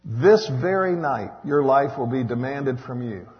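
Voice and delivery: forceful preacher reading the Bible aloud; scripture reading. Acted.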